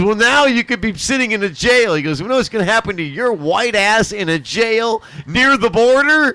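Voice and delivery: high, loud mock threat; threatening cop. Border cop warning the kid about jail, a loud mock threat. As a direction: lean in and threaten him with jail. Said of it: "He goes, he goes".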